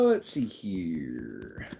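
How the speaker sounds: slow, low whisper to himself; a soft whispered mutter while searching; whispered aside. Real, not acted. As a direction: whisper it slowly while looking.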